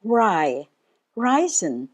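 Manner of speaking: "reisen" with the weight on REI; teacher's demo of "reisen", REI stressed; stress on first syllable. Shown as a demonstration. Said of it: "The stress is on the first syllable".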